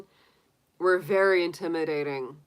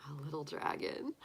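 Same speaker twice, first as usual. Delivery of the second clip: ordinary delivery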